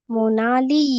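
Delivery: मोनाली with the last ee stretched; long final vowel. Shown as a demonstration. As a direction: linger on the ली ending of मोनाली. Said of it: "ऐसा लंबा खींचा जाता है".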